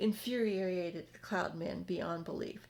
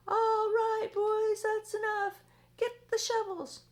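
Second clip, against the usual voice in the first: much higher, loud foreman's call; loud shout; 13 semitones higher, 8 dB louder, slower than usual. Acted. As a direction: bellow orders across the cloud. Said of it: "they heard him shout".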